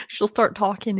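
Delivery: ordinary talk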